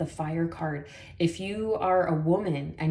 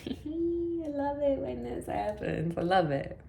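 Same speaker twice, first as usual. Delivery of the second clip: laughing with delight; 8 semitones higher, 4 dB quieter than usual. Real, not acted. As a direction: giggle through the words. Delighted at the drawn card.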